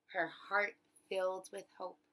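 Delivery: usual speaking voice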